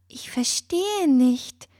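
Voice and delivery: put-on slow, hesitant girl's voice; stammering. A narrator's reading. Fine confused by the bird's tale, slow stammering voice. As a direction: stumble over the words, confused. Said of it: "stammelte Fine".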